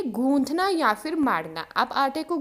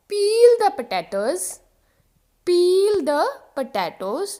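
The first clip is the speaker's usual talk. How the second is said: peel the potatoes, slow and higher; slow emphatic model; 4 semitones higher, 4 dB louder, much slower than usual. Shown as a demonstration. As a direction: call it out slowly to the class. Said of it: "example देखो". (धीमा, ऊँचा और ज़ोरदार)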